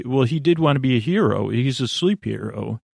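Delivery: ordinary delivery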